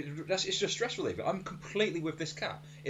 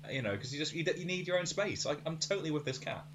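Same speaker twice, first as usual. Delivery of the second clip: ordinary delivery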